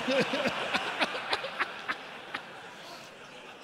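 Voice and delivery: high, breathless helpless laugh; wheezing laugh at the awkward moment; big wheezing laugh. Real, not acted. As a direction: collapse into silent laughter. Audience-style big laugh.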